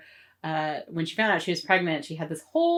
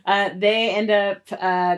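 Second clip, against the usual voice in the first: ordinary talk, nothing marked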